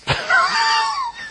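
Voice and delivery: sudden high cackle; high cackling laugh. Real, not acted. Preacher cackling after mocking the ladies, high and sudden. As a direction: burst out laughing at his own mockery.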